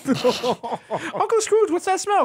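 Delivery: very high, squeaky duckling voice; hammed-up high cartoon voice; cartoon duck skit. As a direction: pipe up high like an animated kid. Voices nephews and Scrooge skit.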